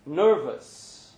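usual speaking voice

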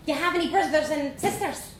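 put-on high, bold Dublin girl voice; young Dublin girl. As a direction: play a cheeky little Dublin girl. Vanessa asks.

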